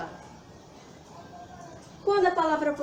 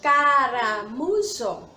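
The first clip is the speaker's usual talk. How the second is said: caramujo drawn out slowly, louder opening syllable; slow word demonstration; 4 dB louder, much slower than usual. Shown as a demonstration. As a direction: stretch out each syllable of caramujo. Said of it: "A nossa última palavra".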